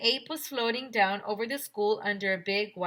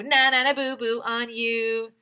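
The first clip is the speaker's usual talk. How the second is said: sing-song teasing, louder and higher; taunting; 5 semitones higher, 9 dB louder than usual. Acted. Narrator taunting the reader, sing-song tease. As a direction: tease the reader like a kid in the playground.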